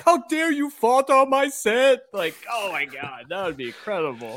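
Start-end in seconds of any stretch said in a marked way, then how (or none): 0.0-2.0 higher, hammed-up indignant outburst; mock outraged yell. Imagining Tommy yelling at crew, mock fury. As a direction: play a diva director losing it, high and comic. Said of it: "And he's like".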